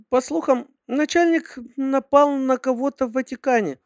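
put-on high girly secretary voice; sweet, high-pitched young secretary; cartoon princess. A narrator's reading. Secretary gossiping in voice.

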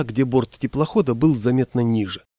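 usual speaking voice

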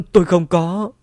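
high, loud protest; panicked denial. A narrator's reading. Cornered wife denies abusing her husband, panicked denial. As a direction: blurt out a frantic denial. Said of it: "liên tục lắc đầu nói".

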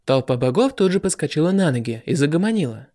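usual speaking voice

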